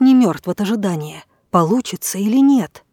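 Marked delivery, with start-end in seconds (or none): none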